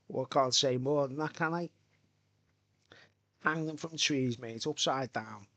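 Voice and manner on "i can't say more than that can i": ordinary talk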